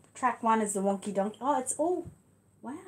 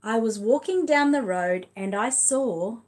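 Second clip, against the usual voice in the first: big, louder read-aloud voice, stretched slowly; reading to children; 2 semitones higher, 6 dB louder, slower than usual. Acted. Reading The Wonky Donkey to her son and viewers, lively read-aloud. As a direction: tell it like a lively picture book for little ones. Reads picture book aloud.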